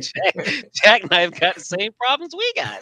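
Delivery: high-pitched, amused disbelief; laughing while talking. Real, not acted. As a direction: squeeze the words out through laughter. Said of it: "I'm just laughing".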